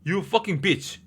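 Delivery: ordinary delivery, nothing marked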